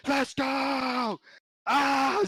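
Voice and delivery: high, roaring victory yell; imitated roar. Player celebrating a round eleven win, high imitated roar. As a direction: roar it out like a player who just won. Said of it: "Aaron Hectic just roar".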